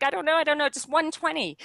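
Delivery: mock panic, blurting out any answer; flustered panic. Retelling to the host how she blurted a lane time, mock panicked. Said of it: "And I'm like".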